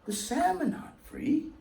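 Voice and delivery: usual speaking voice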